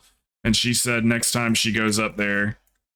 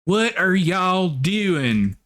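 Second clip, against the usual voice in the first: very slow, much higher Southern twang; country teacher impression; 8 semitones higher, much slower than usual. Imitating her real country teacher calling out to the class, slow Southern drawl. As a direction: stretch it out like a drawling Southern schoolteacher. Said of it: "used to have this real country teacher".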